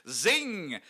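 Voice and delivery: high, loud triumphant zing; gleeful zing. Real, not acted. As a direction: land the joke with glee. Teasing comeback.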